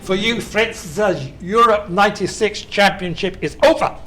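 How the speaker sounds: badly faked wartime German accent; mock German accent. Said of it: "I can't do a Nazi accent".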